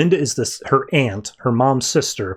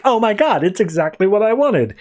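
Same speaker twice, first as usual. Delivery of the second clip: mock-thrilled, pitched up; retold excited kid; 5 semitones higher than usual. Cindy finds Harley under the tree, retold excitement. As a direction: imitate a delighted child lightly. Girl thrilled by her present.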